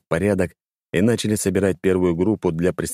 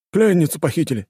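high, loud urgent report; urgent alarm; 10 semitones higher, 3 dB louder than usual. Acted. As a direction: blurt out the bad news. Urgent news of kidnapping.

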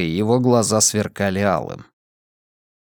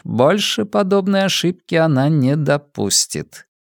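plain reading voice

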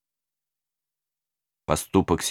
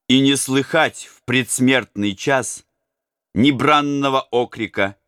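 ordinary delivery

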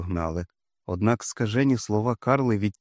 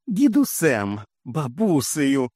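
higher, slow shrill squeal; shrieked; 5 semitones higher, 3 dB louder, much slower than usual. A narrator's reading. Mother shrieking at the news, high and slow. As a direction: squeal the words in disbelief. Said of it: "вискнула вона".

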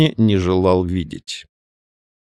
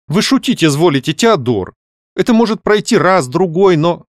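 higher, animated lady objecting; lady novelist, protesting; 7 semitones higher than usual. A narrator's reading. Каролина protests to Теодор about his suggestion, animated. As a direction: object to his teasing, voice lifted.

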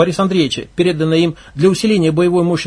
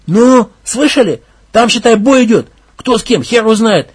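alarmed loud; 5 semitones higher, 5 dB louder than usual. Acted. Leader alarmed by gunfire.

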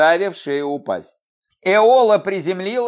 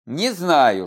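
ordinary delivery, nothing marked

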